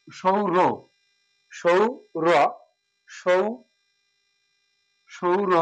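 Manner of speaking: সৌর and জগৎ sounded out slowly with a palatal "sh"; slow syllables. Shown as a demonstration. Teacher sounding out সৌরজগৎ piece by piece. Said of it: "তালব্য স ব্যবহার করব".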